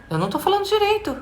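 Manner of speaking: gasp, then high, rushed disbelief; child's dismay. Retold or imitated. Child taking in a parent's correction, acted dismay. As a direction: act out a shocked child, high and quick. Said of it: "ela entende assim".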